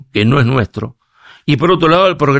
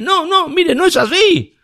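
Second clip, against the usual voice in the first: lightly voiced high-pitched mock reply, laughing; 14 semitones higher, slower than usual. Speaker mocks a friend who changed his name, in a high mock voice. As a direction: toss off a high, teasing no.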